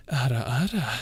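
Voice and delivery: lightly voiced "Ara, ara" with a sly lilt; teasing ara ara. Asked by chat, a playful "Ara, ara" tease. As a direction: purr "Ara, ara" like a teasing anime big sister. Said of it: "can you say "Ara, ara".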